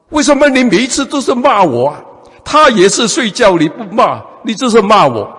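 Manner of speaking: cheeky student protest. Retold or imitated. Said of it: "这个同学很厉害啊".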